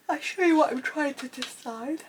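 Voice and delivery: talking through a yawn; stretched, yawning words. Real, not acted. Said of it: "I'm yawning away".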